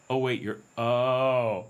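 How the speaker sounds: slow, drawn-out sudden realization; dawning realization. Retold or imitated. Locals realizing he's a big guy, slow surprised realization. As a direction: let it dawn on them mid-sentence.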